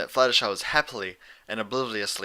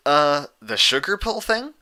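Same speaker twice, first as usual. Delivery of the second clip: unsure question; 5 semitones higher, 6 dB louder, slower than usual. A narrator's reading. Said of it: "Rainbow Dash asked".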